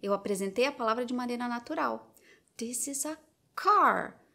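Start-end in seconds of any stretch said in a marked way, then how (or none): 2.6-4.2 "car" stretched slowly with a falling tune; slow and emphatic. Shown as a demonstration. Teacher models new word for toddler, slow emphasis. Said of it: "Falei mais devagar, dei mais ênfase".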